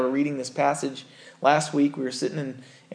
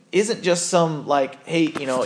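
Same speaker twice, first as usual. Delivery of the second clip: normal talking voice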